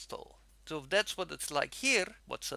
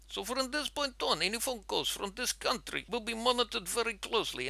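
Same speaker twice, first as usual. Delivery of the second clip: official warning in a put-on Russian accent, pitched up; fake Russian accent; 3 semitones higher than usual. Fake consortium man warning a phone scammer, stern Russian accent. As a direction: threaten like a Russian agent, firm and formal. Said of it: "in this accent, I told the person".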